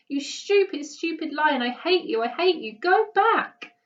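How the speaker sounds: high, loud tearful shouting; angry yell. A narrator's reading. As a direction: drive the lion off with fury. Boy yells at lion.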